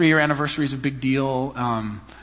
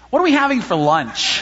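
put-on small boy's question, higher; child's question; 7 semitones higher, 3 dB louder than usual. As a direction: voice the little son asking about lunch. Voicing little foster son.

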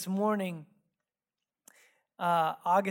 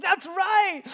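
high, loud gloating shout; retold angry yell; 12 semitones higher, 9 dB louder than usual. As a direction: shout it back like a childish, furious dad. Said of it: "I lost it".

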